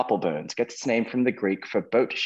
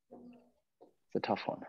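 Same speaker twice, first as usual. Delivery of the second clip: whispered aside; 14 dB quieter, much slower than usual. Real, not acted. Quizmaster's quiet aside after answer.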